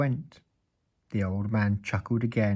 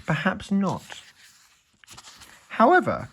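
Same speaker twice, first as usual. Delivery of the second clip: high, teasing wizard voice; 9 semitones higher than usual. A narrator's reading. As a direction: toy with him.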